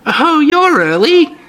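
put-on high, slow old woman voice; sweet, creepy old lady.

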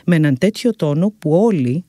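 plain reading voice

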